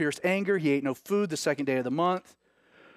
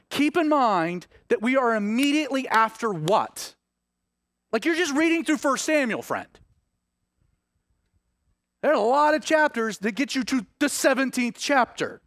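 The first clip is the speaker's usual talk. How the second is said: higher, louder, slowing at the peak; fervent preaching; 10 semitones higher, 5 dB louder than usual. Acted. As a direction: lift the congregation with rising wonder. Preacher builds to fervent climax.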